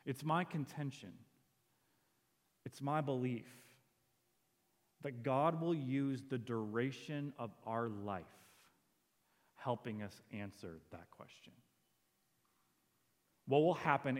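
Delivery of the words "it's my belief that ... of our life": ordinary talk, nothing marked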